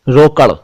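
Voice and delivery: रोकड़ ending in a flapped retroflex ड़; careful flap. Shown as a demonstration. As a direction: land रोकड़ on a flicked ड़, not र. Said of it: "रोकड़ तो कुछ और".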